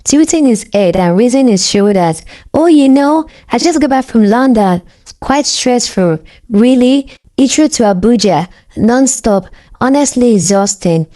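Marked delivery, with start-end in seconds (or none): 2.5-11.1 put-on British accent. Femi boasting to his mirror, lightly voiced British accent. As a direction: fake the British accent of a man back from London. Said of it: "he said in crisp British English".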